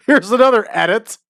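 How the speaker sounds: high voice, barely holding back laughter; helpless amusement. Real, not acted. Still laughing at the absurd incident.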